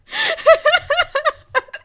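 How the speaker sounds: loud, high, helpless laugh; sudden loud cackle; big burst of laughter. Real, not acted. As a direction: crack up out loud at your own story. Laughing at own recap.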